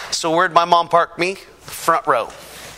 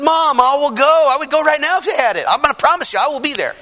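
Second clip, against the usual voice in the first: higher, louder earnest promise; earnest pleading; 10 semitones higher, 4 dB louder than usual. Retold or imitated. Son promises crying mom he'll come, higher and louder. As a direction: beg her to believe him.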